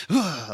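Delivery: sharp loud fake lunge yell; mock attack yell. Wife playfully pretending to stab husband, mock yell. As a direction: yell like you're lunging with a knife.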